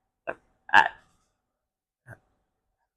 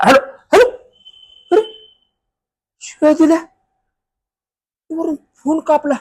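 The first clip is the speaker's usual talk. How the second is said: high-pitched, affronted hello; acted offended disbelief; indignant disbelief; 8 semitones higher than usual. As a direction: voice jumping up in outraged surprise. Sworn at and hung up on.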